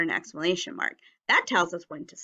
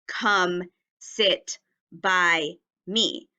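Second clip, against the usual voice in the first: "Come sit by me" said very slowly with space between words; careful model reading; slow and spaced; 2 semitones lower, much slower than usual. Shown as a demonstration. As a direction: stretch it out word by word. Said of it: "My sentence should say".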